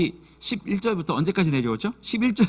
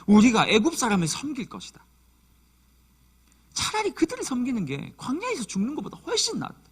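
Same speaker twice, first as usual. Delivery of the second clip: whining Israelites; 6 semitones higher than usual. Acted. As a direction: whine it like the people grumbling at Moses. Voicing the grumbling Israelites.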